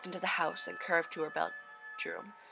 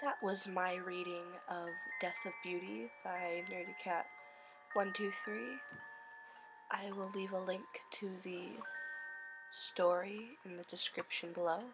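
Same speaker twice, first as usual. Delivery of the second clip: soft eerie whisper; hushed creeping horror; 10 dB quieter than usual. Acted. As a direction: breathe it out like a stalker.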